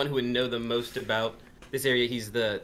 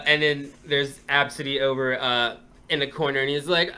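ordinary talk, nothing marked

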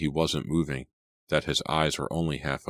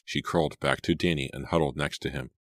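plain reading voice

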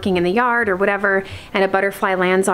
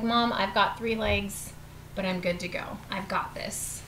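soft, fading pet voice; lightly voiced dog; 12 dB quieter than usual. Dog reassuring Mom about three legs, lightly voiced. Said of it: "He's like".

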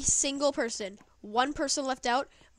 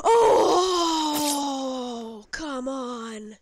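anguished groan; 5 dB louder, much slower than usual. Real, not acted. Losing the round.

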